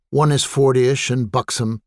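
plain reading voice

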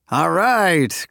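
a host cheering his friend's serious drink; crowing. A narrator's reading. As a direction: crow it out in triumph. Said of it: "crowed Brian".

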